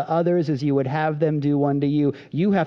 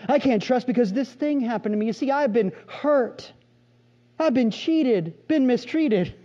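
melodramatic self-pity; 6 semitones higher than usual. Retold or imitated. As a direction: ham up the victim story. The music swells in the background.

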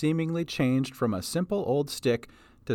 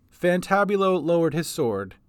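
usual speaking voice

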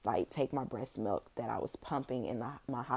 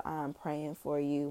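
ordinary talk, nothing marked

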